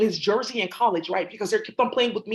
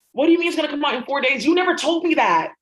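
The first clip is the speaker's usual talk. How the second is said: higher and louder, fast, accusing; indignant outburst; 6 semitones higher, 5 dB louder than usual. Acted. Confronting the baby broker, a fast indignant outburst. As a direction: demand answers, fast and affronted. Acted quarrel over baby timing.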